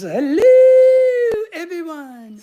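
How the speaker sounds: high, loud hello that falls away; cheery greeting. Acted. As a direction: call out a drawn-out hello for a viewer. Reads out a viewer's greeting.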